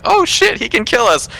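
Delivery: high-pitched squeal of sudden realization; hammed-up alarm, pitch shooting way up; mock panic. Friends realize the movie killer can hurt them, mock panic.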